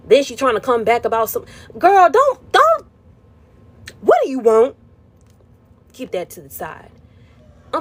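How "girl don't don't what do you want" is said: put-on high squeaky girl don't; shrill mock warning, pitched way up. Mocking the returning client.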